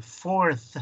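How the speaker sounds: "fourth" ending in a clear th; careful "fourth", th blown out at the end; audible final th. Shown as a demonstration. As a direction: let the th at the end of "fourth" be heard. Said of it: "que se oiga ese th".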